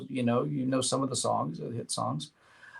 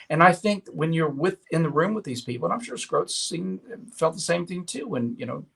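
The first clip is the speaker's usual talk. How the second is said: ordinary talk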